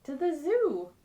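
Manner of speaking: joyful shout off to the zoo; cheerful exclaim. Acted. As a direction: announce the trip with glee. Happy ending, off to zoo.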